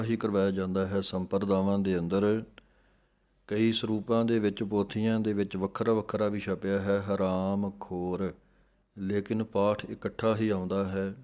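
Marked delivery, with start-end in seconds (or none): none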